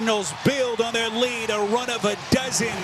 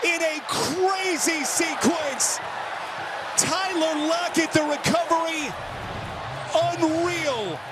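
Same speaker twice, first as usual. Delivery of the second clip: amazed play-by-play; 10 semitones higher, slower than usual. Real, not acted. Reacting to crazy play.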